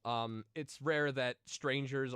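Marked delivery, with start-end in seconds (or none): none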